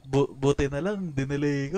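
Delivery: loud teasing imitation of a teammate; mock quote. Teasing a teammate by quoting them back, loud mock quote. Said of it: "Parang sabi niya".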